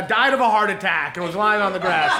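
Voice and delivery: ordinary delivery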